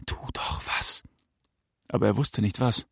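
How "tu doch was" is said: urgent whisper without any voice. A narrator's reading. Voice in the father's head urging action, whispered. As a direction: whisper the plea, very softly. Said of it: "flüsterte eine Stimme in seinem Kopf".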